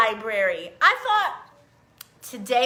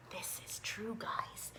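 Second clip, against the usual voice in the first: whispered aside; 3 semitones lower, 19 dB quieter, slower than usual. Real, not acted. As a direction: lean in and whisper it.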